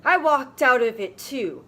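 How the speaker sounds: ordinary talk, nothing marked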